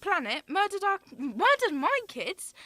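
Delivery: anguished shouting accusation; angry accusation. Acted. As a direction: hurl the blame at her. Grieving accusation in a quarrel.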